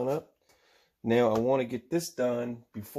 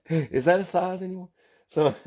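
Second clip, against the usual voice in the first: real giggles breaking through the words; amused, laughing at his own question; 8 semitones higher, 4 dB louder than usual. Real, not acted. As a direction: talk through a real chuckle, a bit higher. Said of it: "I'm sorry I'm laughing so much".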